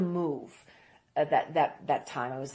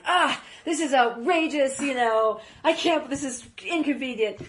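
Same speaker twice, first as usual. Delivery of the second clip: mock outrage; 7 semitones higher, 5 dB louder than usual. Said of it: "and I'm like".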